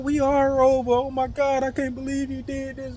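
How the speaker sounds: high, sustained mock wail; imitated crying. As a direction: plead like a tearful woman, imitated. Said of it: "she was over there crying".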